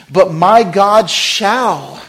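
very slow, loud, high proclamation; preached scripture. Acted. Preacher proclaiming Philippians to the church, slow and loud. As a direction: thunder the verse out.

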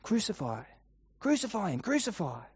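understated crowd chant, held-back volume; repeated muted cries; mock shouting. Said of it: "They're shouting".

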